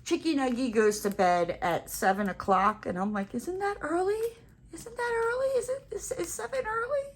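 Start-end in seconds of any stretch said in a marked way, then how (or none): 3.3-7.0 much higher, teary, whiny pleading; mock whiny protest. Protesting to her family about the baby's early bedtime, high teary whine. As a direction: whine it like a sulky child on the verge of tears.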